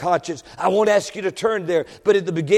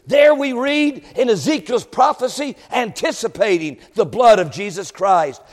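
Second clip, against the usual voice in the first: normal talking voice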